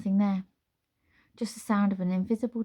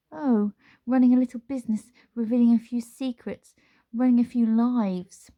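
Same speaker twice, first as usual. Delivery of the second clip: higher, louder gloating witch; sly witch voice; 5 semitones higher, 8 dB louder than usual. Acted. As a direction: savour each word like a villain. Witch boasting to Nine.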